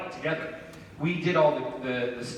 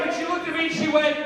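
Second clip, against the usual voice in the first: usual speaking voice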